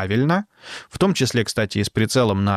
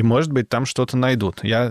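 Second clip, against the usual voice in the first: ordinary delivery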